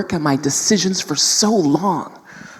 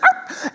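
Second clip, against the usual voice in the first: mock growl. Boy growls on the playground.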